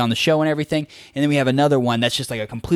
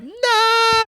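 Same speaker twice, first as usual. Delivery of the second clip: very high, squeaky "Nah"; a jokey yelp of refusal; mock dismissive. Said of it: "I was like".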